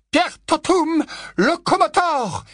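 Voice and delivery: high, drawn-out spell casting; cried-out incantation. A narrator's reading. Professor bringing the statues to life, crying out the spell. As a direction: proclaim the spell at high pitch. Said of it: "s'écria le professeur McGonagall".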